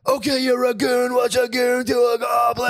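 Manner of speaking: high-pitched, drawn-out yell; retold yell. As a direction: yell it high and stretch it out like a ranting stranger. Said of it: "dude, he yelled that".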